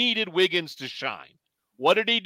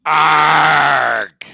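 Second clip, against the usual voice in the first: gruff frustrated growl; angry old man growl; 3 semitones lower, 8 dB louder than usual. Acted. Old man rant opener.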